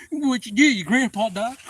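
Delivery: very high, loud mocking taunt; cruel taunt. Bully jeering about the dead grandpa, loud and high. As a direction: jeer at his grief.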